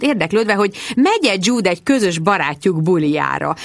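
plain reading voice

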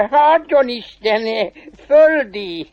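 high, drawn-out devil greeting; chuckling polite devil. Acted. Devil politely greeting the strong stranger, chuckling and high-voiced. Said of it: "Mindjárt köszönti is, mégpedig illedelmesen".

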